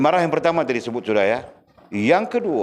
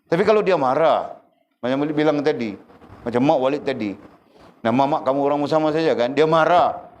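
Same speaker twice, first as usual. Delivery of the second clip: ordinary talk, nothing marked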